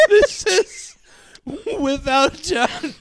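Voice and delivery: high, slow words squeezed through laughter; high voice lost in a giggling fit; helpless laughter. Real, not acted. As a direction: try to talk while cracking up.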